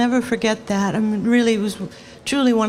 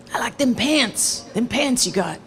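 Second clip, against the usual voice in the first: put-on raspy old man voice. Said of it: "they both tie the raspy voice".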